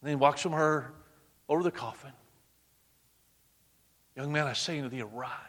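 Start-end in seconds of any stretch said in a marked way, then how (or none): 4.2-5.4 soft, hushed call to rise; hushed command. Acted. Jesus softly speaks to the dead son, hushed.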